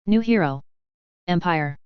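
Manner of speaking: usual speaking voice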